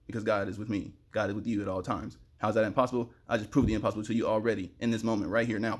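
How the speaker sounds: ordinary delivery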